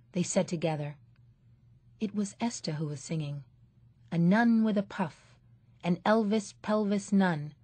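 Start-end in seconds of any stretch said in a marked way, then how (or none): none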